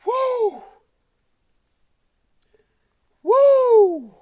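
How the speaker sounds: high, loud triumphant whoops; joyful whoop. Real, not acted. Hiker cheering at the summit he reached, loud joyful whoops. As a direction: let out a real victory cheer.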